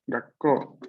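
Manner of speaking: Gakko con un corte antes de ko; retén la K y alarga el ko final; consonante doble. Shown as a demonstration. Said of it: "pequeño tsu está antes de ko". (pronuncia Gakko marcando la pausa de la K doble)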